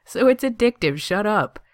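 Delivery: high, loud, defensive outburst; playful indignant comeback; protesting. A narrator's reading. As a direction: protest it loudly, pitched right up. Teased friend protests, laughing it off.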